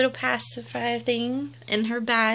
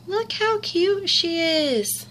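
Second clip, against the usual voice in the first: higher, slow, drawn-out cooing; gushing coo; 6 semitones higher, slower than usual. Real, not acted. Gushing over the doll's face.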